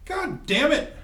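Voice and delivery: frustrated outburst. Real, not acted.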